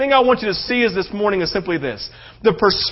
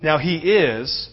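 much softer, low intimate sermon voice; quiet preaching; 9 semitones lower, 10 dB quieter than usual. Acted. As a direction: draw the congregation close, hushed.

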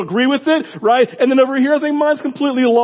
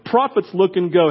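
ordinary talk

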